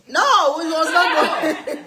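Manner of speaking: high, loud, slow laughing no; laughing protest. Real, not acted. Mother laughing off her kids' teasing. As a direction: laugh it off loudly, drawn out.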